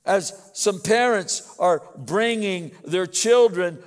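loud, emphatic sermon delivery; forceful preaching. Real, not acted. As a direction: preach it out to the congregation.

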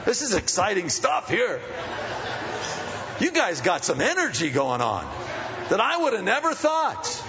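high laughing whoa; amused surprise. Retold or imitated. Counselor teasing a yelling couple, amused. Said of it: "And I'm going".

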